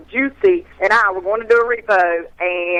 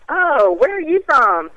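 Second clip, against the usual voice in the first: higher, slow, drawn-out question; coy retold question; 6 semitones higher, slower than usual. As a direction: play up the interest, slow and high. Acting out her reply to admirer.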